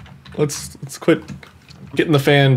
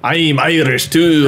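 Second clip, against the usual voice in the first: drawn-out, badly faked Irish; botched Irish accent; slower than usual. Retrying the line, badly faked Irish accent. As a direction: have another failed go at Irish. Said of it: "but it's not Irish".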